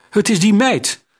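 shouting. A narrator's reading. Accomplice keeps shouting.

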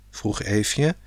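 ordinary delivery, nothing marked

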